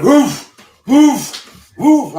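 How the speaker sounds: loud, high explosive "woof" noises; workout grunts. Retold or imitated. Storyteller imitating trainer's noises during a lift, loud grunts. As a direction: imitate a lifter forcing out breath on each rep. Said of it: "He was making these noises like".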